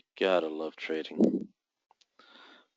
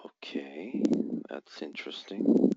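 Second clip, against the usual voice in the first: hushed whisper to himself; 3 semitones lower, 7 dB quieter, slower than usual. Real, not acted. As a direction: breathe the words, no voice. Whispering while watching the chart.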